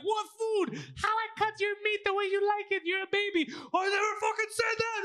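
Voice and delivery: high-pitched mock shouting; retold yelling match. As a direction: shout it like a bickering mother and son. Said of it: "Oh, he's yelling".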